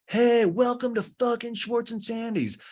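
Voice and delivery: high, hammed-up welcome; Tom. Tom welcomes people to Schwartz and Sandy's, hammed-up excitement.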